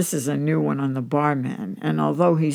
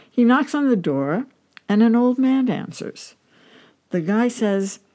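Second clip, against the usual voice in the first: ordinary delivery, nothing marked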